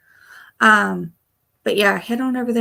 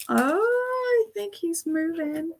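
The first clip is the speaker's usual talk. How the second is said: higher hushed cooing; pet talk; 10 semitones higher, slower than usual. Real, not acted. Watching the cat.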